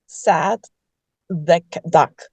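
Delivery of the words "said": usual speaking voice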